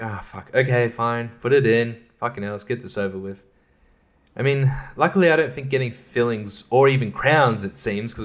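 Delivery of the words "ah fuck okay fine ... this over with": quiet, quick resigned grumble. Retold or imitated. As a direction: give in with a muttered sigh. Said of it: "I'm like".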